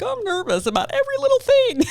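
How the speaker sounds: squeaky high mock-nervous voice; nervous self. Mocking her own nervousness next to eager Brian, squeaky and high. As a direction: send yourself up as a jittery worrier, pitched very high. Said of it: "And me, I'm like".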